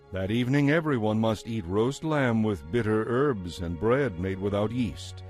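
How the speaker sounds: ordinary delivery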